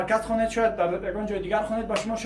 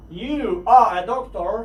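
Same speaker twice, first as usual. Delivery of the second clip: slow, careful full form You are; drawn out You are; 5 dB louder, much slower than usual. Shown as a demonstration. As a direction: stretch You are out clearly. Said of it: "باید شما اینها رو حتماً بدونید".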